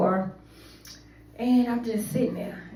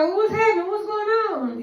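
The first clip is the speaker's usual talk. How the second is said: higher, frantic husband voice; panicked husband; 9 semitones higher than usual. Retold or imitated. Husband calling in a panic, higher frantic voice. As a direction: play the worried husband, pitched up. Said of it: "My husband like".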